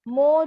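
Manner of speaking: मो drawn on an o vowel; majhool mo. Shown as a demonstration. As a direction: show the wrong मो, o in place of u. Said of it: "जो बोल रही है मजहूल आवाज से". (मो मजहूल आवाज़ में)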